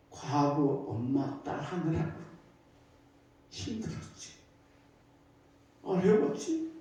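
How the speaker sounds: kneeling mother begging her daughter's forgiveness; tearful apology. Retold or imitated. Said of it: "눈물을 쏟으니까". (무릎 꿇은 엄마가 딸에게 눈물로 속삭이듯 사과)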